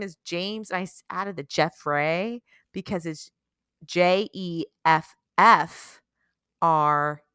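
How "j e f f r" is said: slow, deliberate letter-by-letter spelling; very slow, separated letters. Shown as a demonstration. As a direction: space out each letter. Spells the altered name out.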